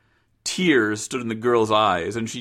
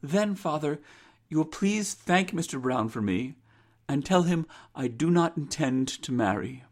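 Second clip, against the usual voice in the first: higher, softer daughter's voice; resolute daughter; 5 semitones higher, 4 dB quieter than usual. Acted. Rose refuses marriage to her father, soft and resolute. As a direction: decline politely but firmly, pitched up.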